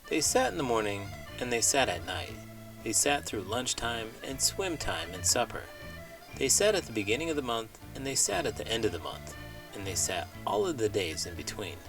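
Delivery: ordinary delivery, nothing marked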